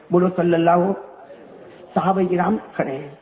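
usual speaking voice

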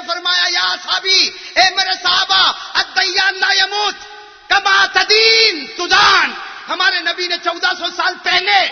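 loud, ringing sermon voice with drawn-out Arabic; fiery preaching. Acted. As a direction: preach it to the whole mosque. Preaching the Friday sermon story. (پرجوش خطبہ، بلند آواز میں)

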